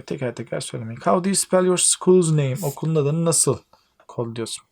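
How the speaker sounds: normal talking voice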